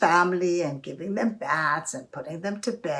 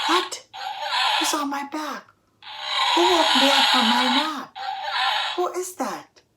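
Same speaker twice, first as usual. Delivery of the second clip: higher-pitched startled lion's outcry; 7 semitones higher, 4 dB louder than usual. Acted. Lion waking to someone on his back, startled. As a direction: wake up startled and indignant, pitched high.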